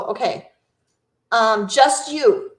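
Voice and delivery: usual speaking voice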